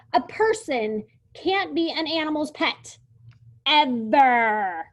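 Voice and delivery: loud, high, bossy lecture. Acted. Lulu lectures the dinosaur about pets in her old bossy voice. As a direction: lay down the rules like a little boss. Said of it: "its old bossy self again".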